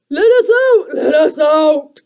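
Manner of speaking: high, loud creature pleas; croaking creakers. A narrator's reading. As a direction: goblins begging to escape. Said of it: "croaked the creakers".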